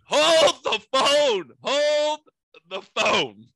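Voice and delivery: loud, high, long-drawn call to halt; hammed-up disbelief, stretching the words out slowly; incredulous interjection. Neighbor halting the young couple's quarrel, loud incredulous outburst.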